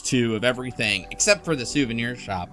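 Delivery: normal talking voice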